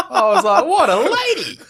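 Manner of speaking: high, loud words through laughter; laughing. Real, not acted. Storyteller delivers his verdict on the lady, laughing. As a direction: squeal the punchline while cracking up.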